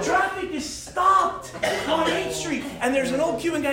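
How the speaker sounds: ordinary talk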